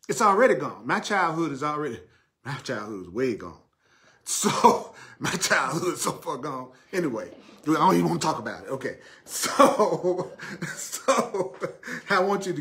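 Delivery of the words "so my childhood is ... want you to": laughing. Real, not acted. Laughing at his own childhood.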